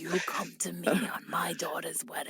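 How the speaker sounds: hushed mafia don impression; very soft mob boss voice; Godfather. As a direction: quote the crime boss under your breath. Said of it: "The entire beginning scene is him, like, sitting in his, you know, office".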